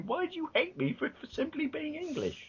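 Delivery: hammed-up hurt English complaint; mock wounded Englishman. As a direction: whine it like a hurt outsider. Mock plea of a hated Englishman.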